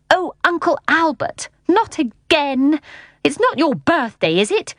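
high-pitched exasperated scolding; cross. A narrator's reading. Mary Poppins scolding her uncle, crossly. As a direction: scold with weary disapproval. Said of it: "Mary Poppins saying crossly".

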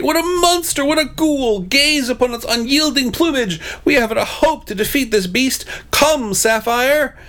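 very high theatrical panic; hammed-up knight in high-pitched terror; screamed horror. A narrator's reading. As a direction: wail and declaim at the top of the range. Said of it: "screamed the Blue Knight".

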